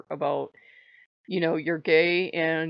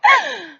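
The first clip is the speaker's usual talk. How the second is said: dramatic gasp; 12 dB louder than usual. Acted. Acting shocked sassy friend.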